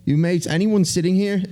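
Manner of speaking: high, chirpy British voice imitating a bloke; mock British bloke asking for seats; British accent. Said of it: "pull out your British accent again".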